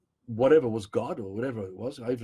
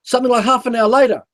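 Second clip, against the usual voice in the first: louder, driven telling of the car chase; raised, urgent voice recounting the head-on swerve; loud intense storytelling; 10 semitones higher, 11 dB louder than usual. Acted. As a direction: push every line out like it still shocks you. Continues intense retelling.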